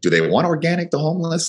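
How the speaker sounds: mock incredulous. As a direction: tease your own silly question. Mocking his own doubt.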